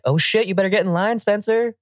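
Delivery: high, urgent mock alarm; mock alarmed warning. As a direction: warn Spencer like it's an emergency. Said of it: "it's like".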